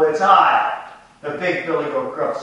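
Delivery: lightly voiced big billy goat, bold and loud; ugly hoarse goat voice. Preacher voicing the big goat answering the troll loudly. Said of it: "who had an ugly, hoarse voice".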